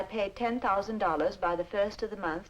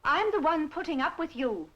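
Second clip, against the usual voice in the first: higher-pitched, aggrieved comeback; quarrelsome, pitch raised; indignant retort; 8 semitones higher, 3 dB louder than usual. Acted. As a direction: turn the complaint back on the nephew.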